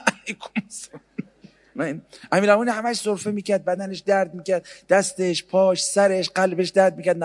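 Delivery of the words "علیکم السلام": high whispered feeble reply; hammed-up frail greeting; mock frail reply. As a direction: whisper it like a sickly saint. Mocking imagined frail imam reply.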